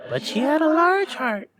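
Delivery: very high, otherworldly spirit; ghost voice. A narrator's reading. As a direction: float it like an eerie spirit. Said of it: "said the ghost".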